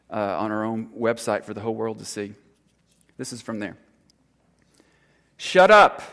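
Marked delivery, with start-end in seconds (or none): 5.4-6.0 sudden high, loud "Shut up"; a mother losing her temper, shouting high; childish snap. Retold or imitated. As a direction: blurt it at your child, much higher and louder. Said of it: "How childish that sounds".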